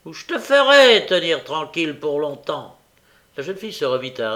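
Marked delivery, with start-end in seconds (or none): 0.0-2.8 loud, raised threat; forceful bullying warning; menacing threat. A narrator's reading. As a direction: come down hard on the opening words. Sikes threatens the girl.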